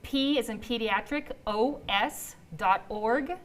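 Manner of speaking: slow, with pauses between chunks; deliberately slow. Real, not acted. Host repeating the website for the back, deliberately slow. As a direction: spell it out slowly, piece by piece. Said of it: "I'm just saying it slow".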